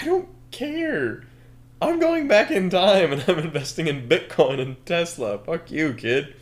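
high, hammed-up defiance easing down; defiant villain. Villain dismissing the kid's warning, high mock-defiant rant.

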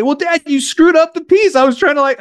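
put-on high, whiny kid's complaint; upset child imitated. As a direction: protest like a cross little kid. Upset child protests to dad.

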